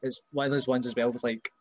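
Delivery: normal talking voice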